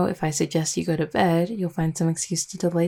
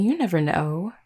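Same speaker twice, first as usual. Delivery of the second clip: ordinary talk, nothing marked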